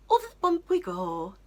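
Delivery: put-on high, loud sing-song baby talk; talking to a child. Mum pushing the wheelchair over a lip, sing-song baby talk. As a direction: coo it like to a toddler. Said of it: "like you would to a child".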